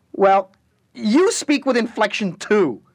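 put-on high, loud, drawn-out childish comeback; petulant retort. The Harlequin at the door hurling a petulant exit line at Alice. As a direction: fling it back like a sulking child. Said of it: "he hurled back petulantly".

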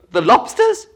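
high, excited shout. A narrator's reading. Gryphon finishes the Mock Turtle's sentence, shouting. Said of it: "shouted the Gryphon".

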